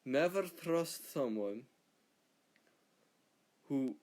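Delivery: slow, solemn mock Scottish proverb; Scottish saying. Scot telling a proverb about the English, in a slow light Scottish accent. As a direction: intone it like a lightly put-on wise old Scot. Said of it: "in Scotland we have a, a saying".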